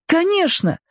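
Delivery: put-on very high, eager boy; ringing boy's voice. A narrator's reading. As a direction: answer as a keen boy, pitched right up. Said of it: "звонко сказал мальчик". (звонко, голосом мальчика)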